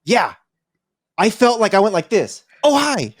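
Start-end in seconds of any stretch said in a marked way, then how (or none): 2.6-3.1 quick, high-pitched startled hi; super-fast greeting. Acted. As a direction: act out a sudden, speedy greeting. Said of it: "Like, super fast".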